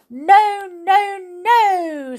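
high, loud creature's cry; shouting. A narrator's reading. Creature rejecting the queen's guesses, shouting. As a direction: shout it high, each word held. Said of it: "shouted the little creature".

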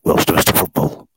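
slow whispered show announcement; late-night whisper. Pretend. Host pitching a late football show, in a stagey whisper.

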